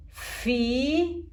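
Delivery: "fi" opening on a hissing f; long hissed f. Shown as a demonstration. Teacher reads syllables to pupils, "fi" with long hissed f. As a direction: hold the f before the vowel of "fi". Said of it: "La f del gato enojado".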